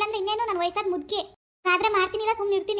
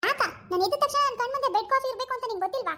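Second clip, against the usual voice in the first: mocking the daughter-in-law; 6 semitones higher than usual. Mother-in-law mimics lazy daughter-in-law demanding bed coffee. Spoiled wife voiced.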